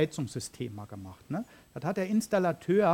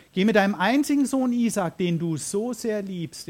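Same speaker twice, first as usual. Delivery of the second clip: commanding voice of God; 5 semitones higher, 7 dB louder than usual. Acted. God commands Abraham in reading.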